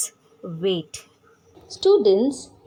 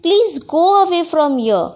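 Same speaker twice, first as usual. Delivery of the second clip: put-on higher, slightly louder childlike complaint; acted annoyed scolding; 4 semitones higher, 4 dB louder than usual. Jealous girl tells baby sister to go away, acted annoyance. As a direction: shoo someone off, crossly pleading.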